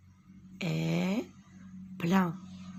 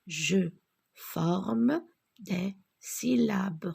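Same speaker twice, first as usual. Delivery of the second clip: slow syllables; slower than usual. Shown as a demonstration. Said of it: "j'apprends à former des syllabes".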